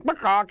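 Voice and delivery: high clucking squawk; a dim chicken's bok. Shown as a demonstration. Actor demoing the rooster walking along, chicken cluck. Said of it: "he's gonna sound like chickens".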